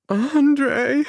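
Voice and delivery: high, loud sobbed cry; sobbing. A narrator's reading. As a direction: break his name in sobs. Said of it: "Michelle sobs my name in broken pieces".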